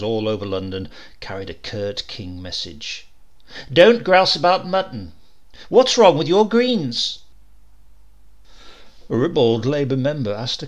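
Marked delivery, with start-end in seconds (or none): 3.7-7.2 higher, louder poster shout; a bold wartime poster slogan, read loud and slow; brash slogan. A narrator's reading. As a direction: bark it like an advert.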